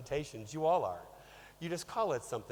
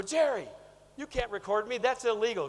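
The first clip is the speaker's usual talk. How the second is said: higher and louder, a hammed-up protest; mock outraged protest; 7 semitones higher, 6 dB louder than usual. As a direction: protest loudly like you've been caught on tape. Protesting being secretly recorded.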